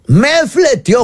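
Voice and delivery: exclamación más alta y más fuerte; grito imitado del taxista. Retold or imitated. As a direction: suelta la queja gritando, imitando al taxista. Taxista cuenta su divorcio dolido. (el taxista grita que su esposa lo echó)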